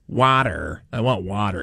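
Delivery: "water" with open "wah" and a final r; American "water". Retold or imitated. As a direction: imitate the American "water", sounding the r. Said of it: "No, no".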